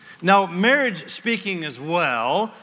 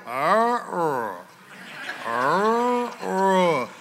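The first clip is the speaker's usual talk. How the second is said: straining grunts; much slower than usual. Retold or imitated. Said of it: "I did the thing where I go".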